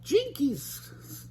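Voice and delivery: high cartoon Jinkies; high surprised Jinkies; Velma exclaiming. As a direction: gasp it out as Velma. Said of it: "exclaimed Velma".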